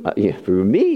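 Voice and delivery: ordinary delivery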